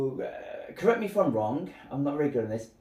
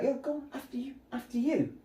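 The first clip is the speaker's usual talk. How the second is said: higher, softer, overly polite Landa; a lightly voiced courteous villain; mock polite host; 10 semitones higher, 4 dB quieter, faster than usual. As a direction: coax her sweetly to go first. Landa urges her to eat.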